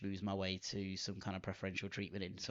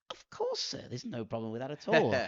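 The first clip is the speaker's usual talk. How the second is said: high, polished Scottish receptionist voice, hammed up; mock polite receptionist; 5 semitones higher, 6 dB louder than usual. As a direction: chirp it like an eager hotel receptionist. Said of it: "she's just gone".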